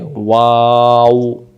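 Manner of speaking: ওয়াও held very long through rounded lips; slow teacher model of ওয়াও; ওয়াও stretched very slowly; drawn-out waw. Shown as a demonstration. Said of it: "দুই ঠোঁটের মাঝখানের ফাঁকা".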